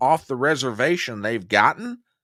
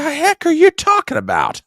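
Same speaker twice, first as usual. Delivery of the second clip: incredulous; 13 semitones higher, 4 dB louder than usual. Real, not acted. Host reacts in disbelief to clip.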